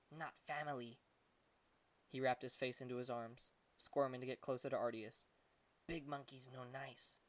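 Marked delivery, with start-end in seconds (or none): 0.1-1.0 soft, muffled little creature voice; a little monkey mumbling into his arms, much softer; small monkey voice. A narrator's reading. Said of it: "His voice was muffled". 5.9-7.2 slow, much softer, muffled into his arms; a little monkey mumbling with his face hidden. A narrator's reading. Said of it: "His voice was muffled inside of his arms".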